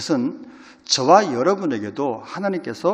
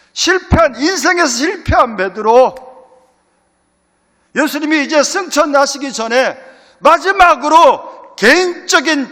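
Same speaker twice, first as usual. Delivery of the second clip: loud, much higher preaching climb; fiery preaching; 10 semitones higher, 12 dB louder than usual. Acted. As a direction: drive the point home from the pulpit. Preacher rises to full force. (힘차게 외치는 설교조)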